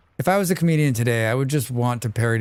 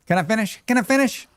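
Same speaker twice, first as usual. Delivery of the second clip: higher pitch, climbing on the repeat; whiny Perot; 9 semitones higher, faster than usual. Retold or imitated. Streamer repeats Perot's debate line, high and whiny. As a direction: whine like a Texan interrupted. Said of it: "Yes, whiny".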